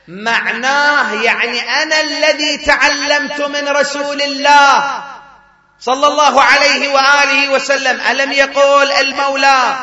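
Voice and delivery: climbing sermon fervour; rising preaching. Real, not acted. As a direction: preach it out at full force.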